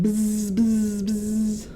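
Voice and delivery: playful bee buzz; a little girl's mock bee buzz. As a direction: buzz like a bee, child at play. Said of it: "She ran around going".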